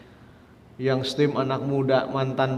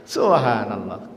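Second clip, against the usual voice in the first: ordinary talk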